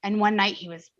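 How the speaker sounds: usual speaking voice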